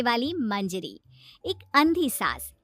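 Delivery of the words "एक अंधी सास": plain reading voice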